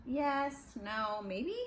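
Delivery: usual speaking voice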